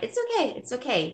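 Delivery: high-pitched, light reassuring quote; retold reassurance. Boss letting apologetic workers off, high mock reassurance. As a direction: lilt it high, imitating soothing someone.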